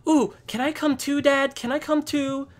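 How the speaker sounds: put-on very high, excited child pleading; eager little boy. Boy begging his dad to come along, eager child voice. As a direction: beg to tag along in a squeaky child voice. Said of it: "Jory said".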